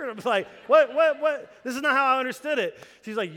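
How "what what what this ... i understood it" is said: loud, high, fast rush of words; flustered dad caught off guard; panicked disbelief. Retold or imitated. Panicked husband told baby's coming.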